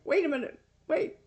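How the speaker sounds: sudden high wait a minute; startled wait. Retold or imitated. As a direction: jump in, startled. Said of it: "I was like".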